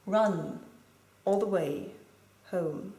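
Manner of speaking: "Run... all the way... home", broken by pauses; measured line-by-line reading, dropping away on "home"; slowed, each word emphasized. Shown as a demonstration. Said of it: "slowing down the rhythm and emphasizing each word".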